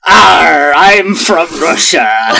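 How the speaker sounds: hammed-up Russian accent, slow; a jokey Russian accent. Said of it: "I'm gonna say Russian".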